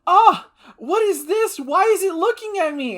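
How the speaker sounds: high, loud mock panic; hammed-up shriek of a horrified character; imitated freak-out. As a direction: act out freaking out, pitch way up. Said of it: "she's freaking out".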